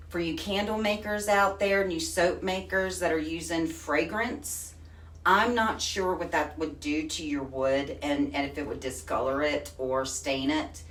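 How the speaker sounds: ordinary talk, nothing marked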